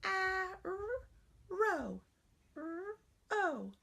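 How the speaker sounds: put-on high childlike sounding out; student sounding out. Shown as a demonstration. Teacher plays a child sounding out arrow in a high voice. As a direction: sound out arrow like a small pupil. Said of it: "Sound it out for me".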